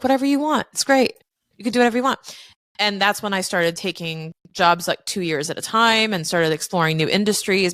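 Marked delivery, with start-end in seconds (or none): 0.0-2.2 lightly raised, upbeat imitation of dad; breezy, high mock-cheerful dad; a retold dad shrugging it off brightly; cheery dad voice.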